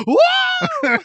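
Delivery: high, loud hammed-up shriek of a burned wraith; mock wraith scream. Ringwraith flees the torch with a loud, high, hammed-up shriek. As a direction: yelp like a monster fleeing fire.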